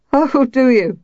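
lightly voiced old woman, teasing; a hint of a mocking old woman. A narrator's reading. Old woman mocks the priest's polite greeting, teasing. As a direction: tease him back, amused.

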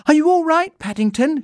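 high, loud worried call; anxious shout. A narrator's reading. Judy at the bathroom door, shouting anxiously to Paddington. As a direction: shout to check on someone out of sight. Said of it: "she shouted".

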